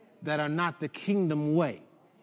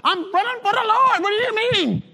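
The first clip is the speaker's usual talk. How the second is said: busy Jamie replying; 9 dB louder than usual. Acted. Jamie answers Jesus, busy.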